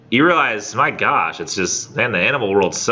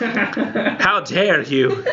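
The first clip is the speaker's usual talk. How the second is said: mock outrage; 9 semitones higher, much slower than usual.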